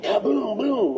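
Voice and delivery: high mock booming gunshot noise; imitated shotgun blast. Retelling the farmer firing his shotgun, a high mock boom. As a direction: pop out a cartoon shotgun boom. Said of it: "he came out and he went".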